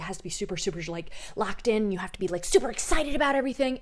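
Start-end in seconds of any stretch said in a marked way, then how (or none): 2.4-3.8 hyped influencer voice. Retold or imitated. Mocks hyped online presenter.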